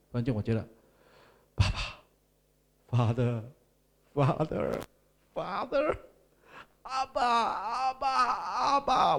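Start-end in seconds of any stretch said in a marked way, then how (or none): 1.5-9.2 high, slow tearful cry of Abba; crying out to father. Retold or imitated. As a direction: cry out like a lost child finding his father. (哭喊着呼唤阿爸)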